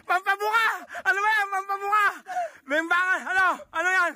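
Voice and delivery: high cheering yell; excited shout. Real, not acted. Cheering wildly, crying shout.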